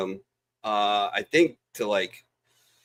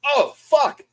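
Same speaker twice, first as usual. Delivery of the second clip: high, loud startled cry; 6 dB louder than usual. Retold or imitated. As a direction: jolt of panic. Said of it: "I'm like".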